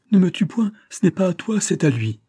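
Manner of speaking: high, loud, frantic plea for her life; a woman cries out in terror to spare her life; terrified cry. A narrator's reading. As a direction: scream it out, begging him to stop. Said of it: "elle lui cria".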